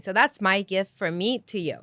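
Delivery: ordinary talk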